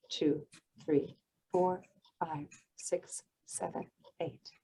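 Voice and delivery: soft slow count fading into a whisper; guided breathing count. Shown as a demonstration. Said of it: "Let's do one more".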